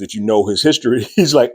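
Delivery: ordinary delivery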